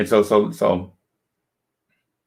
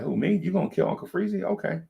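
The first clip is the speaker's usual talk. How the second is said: mock innocent; 10 semitones higher, 7 dB quieter, faster than usual. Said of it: "I was like".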